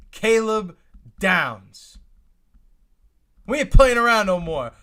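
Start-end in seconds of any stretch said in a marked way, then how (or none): 3.5-4.7 loud, higher, fired-up declaration; hyped shout. Real, not acted. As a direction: shout it like a fan on his feet. Celebrating the Cowboys' pick live.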